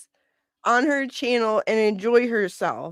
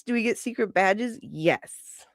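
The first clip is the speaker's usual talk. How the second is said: ordinary delivery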